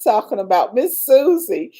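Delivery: usual speaking voice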